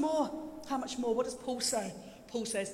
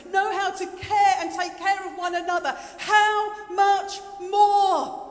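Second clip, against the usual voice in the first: high preaching voice, building to a loud, drawn-out finish; impassioned preaching; 4 semitones higher, 5 dB louder, slower than usual. Acted. Preacher driving home Jesus's words to the congregation, impassioned. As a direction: build slowly to a ringing climax.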